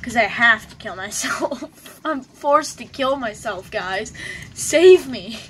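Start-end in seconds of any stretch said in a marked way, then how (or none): 4.6-5.4 loud joking cry for help; shouted plea. Pretend. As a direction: yell it in mock despair.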